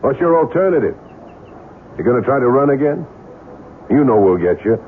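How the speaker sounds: pressing menace. Acted. Gangster presses Al hard.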